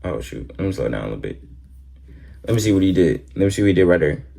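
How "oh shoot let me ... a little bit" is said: low, soft self-talk; muttering to himself. Real, not acted. Reactor fiddling with the replay, muttering to himself. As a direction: murmur it half to yourself, pitch dropped.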